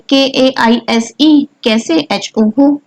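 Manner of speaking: letter by letter, slow; spelled slowly as letters, then read as कैसे; spelling out letters. Shown as a demonstration. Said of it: "लिखोगे तो कैसे लिखोगे".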